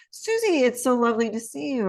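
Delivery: bright welcome that starts very high; sunny hello to a negative aunt; warm assertive greeting. Shown as a demonstration. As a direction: greet her first with real warmth. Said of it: "Assertive would be".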